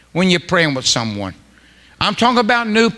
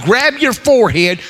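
ordinary talk, nothing marked

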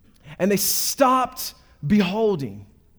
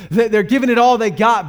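louder, mildly exasperated retelling; mild frustration; 4 semitones higher, 8 dB louder than usual. Retold or imitated. Preacher retelling fruitless hard work, mildly frustrated. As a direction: push it out with a touch of frustration. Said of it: "those frustrating moments, like".

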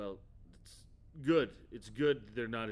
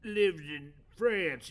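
indignant; 8 semitones higher, 8 dB louder, slower than usual. Acted. Indignant about Franklin.

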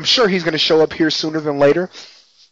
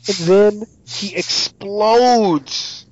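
drawn-out, higher build to the climax; dramatic reveal; 6 semitones higher, much slower than usual. Real, not acted. Recapping the preacher's gory end to the co-host, dramatically slow. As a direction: stretch it out for maximum drama.

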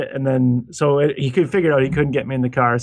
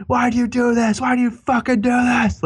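high, strained mock rage at someone; screaming abuse in a high voice; mock angry yell; 9 semitones higher than usual. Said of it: "he's like".

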